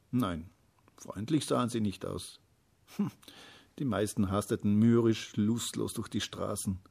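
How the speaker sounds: plain reading voice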